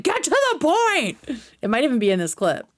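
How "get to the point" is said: high, slow mock yell of impatience; hammed-up shout to get to the point; imitating Dan. Streamer imitating Dan snapping at a rambling guest, high mock yell. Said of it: "Dan eventually is like".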